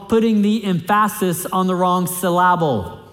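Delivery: "emphasis" as em-FASS-is, "syllable" as sil-LAB-ul; misplaced word stress. Shown as a demonstration. Preacher quoting a theologian's wordplay, "emphasis" and "syllable" stressed on wrong second syllables. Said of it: "you're just not saying it right".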